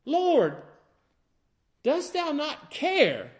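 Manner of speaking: lonesome complaint. Acted. Martha's complaint acted out.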